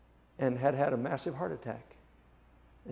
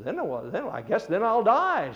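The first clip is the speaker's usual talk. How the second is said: much higher, louder and slow, shaken; dawning alarm; 8 semitones higher, 10 dB louder, slower than usual. Retold or imitated. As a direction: let it build into alarm. Man realizes, louder slower.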